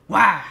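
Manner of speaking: loud sudden imitated bang; mock slam noise. Mimicking the worker throwing food in, loud burst. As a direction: blurt a bang sound.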